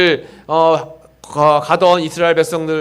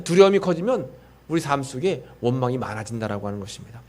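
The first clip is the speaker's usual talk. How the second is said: ordinary delivery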